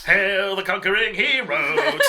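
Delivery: loud, high, drawn-out hail; mock heroic proclamation. Player hails the party's return, mock heroic proclamation. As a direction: proclaim it like a hammed-up herald.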